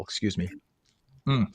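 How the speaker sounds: talking through a mouthful; chewing while talking; mouth full. Real, not acted. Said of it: "talk with my mouth full".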